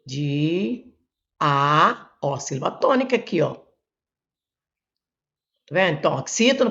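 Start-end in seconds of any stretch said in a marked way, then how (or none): 0.0-2.1 "De", then "A", each held alone with a pause; teacher dividing a word into "De" ... "A"; separated syllables. Shown as a demonstration. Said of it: "separar a sílaba aqui".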